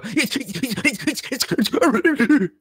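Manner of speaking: high-pitched frantic jabbering; Courage the Cowardly Dog. Acted. Player acts out his character's panic, cartoon dog gibbering. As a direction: babble wordlessly in rapid, high bursts. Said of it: "his impression of, um, Courage the Cowardly Dog".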